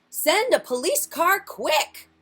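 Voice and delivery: loud, high, drawn-out command; urgent order. Acted. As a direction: call urgently for backup. Policeman urgently orders a car.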